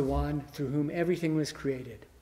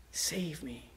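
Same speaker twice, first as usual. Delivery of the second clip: softer, whispered echo; whispered plea; 3 semitones lower, 13 dB quieter than usual. Acted. Preacher echoing David's plea in a whisper.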